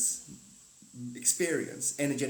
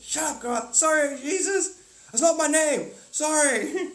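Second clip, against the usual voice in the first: high, loud, laughing through the words; giggling apology while voicing Jesus; 13 semitones higher, 8 dB louder, slower than usual. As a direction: crack up mid-line.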